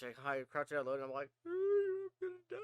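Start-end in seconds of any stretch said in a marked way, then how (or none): 1.5-2.6 very high-pitched fearful squeal; mock whimper. Player crouched hiding from the ghost, high mock whimper. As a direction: squeak it out like a terrified hider.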